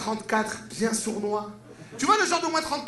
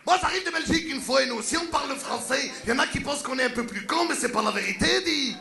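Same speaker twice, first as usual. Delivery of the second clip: put-on Belgian accent; 4 semitones higher than usual. Belgian man arriving from Belgium, Belgian French accent. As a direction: play the cheerful Belgian.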